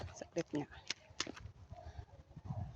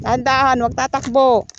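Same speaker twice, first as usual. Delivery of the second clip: loud, raised-pitch caution; called-out warning; 6 semitones higher, 13 dB louder than usual. Real, not acted. Parent telling child not to run, called-out warning.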